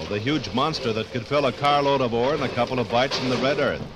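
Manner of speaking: usual speaking voice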